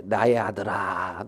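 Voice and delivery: slow, low, soft call; gently calm an angry son; softened, drawn-out fatherly address; tender father. Acted. Father soothes angry elder son.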